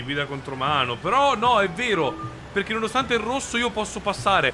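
ordinary delivery

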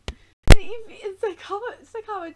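high, loud fangirl squeal of a name; excited squeal. Real, not acted. Fan squealing a character's name at the screen, high and loud.

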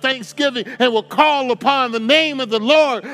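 high, loud preaching climax with a rhythmic cadence; preaching at full force. Acted. Preacher exhorts the congregation at full force, loud and high. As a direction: preach it out, building to a shout.

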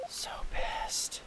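whispered, drained annoyance; quietly pissed. Real, not acted. Player telling viewers he's pissed, whispered frustration. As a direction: mutter it almost soundlessly. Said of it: "I'm pissed right now".

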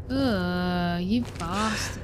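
ordinary talk, nothing marked

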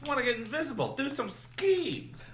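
high, soft put-on voice; squeaky little plotter, dragging out the last word; playful mock schemer. Playing the invisible girl.

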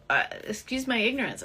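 ordinary talk, nothing marked

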